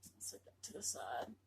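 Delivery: whispered aside, low; soft whispered aside to herself. Real, not acted. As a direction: murmur it under the breath. Aside while unboxing.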